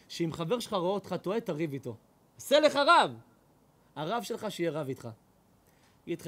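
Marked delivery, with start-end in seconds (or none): 2.4-3.2 shouted call. Acted.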